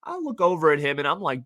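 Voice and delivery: normal talking voice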